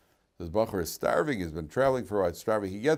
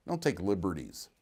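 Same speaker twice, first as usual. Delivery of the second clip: ordinary talk, nothing marked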